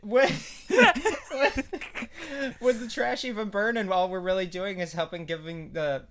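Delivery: high, loud, laughing through it; breaking into laughter mid-question. Real, not acted.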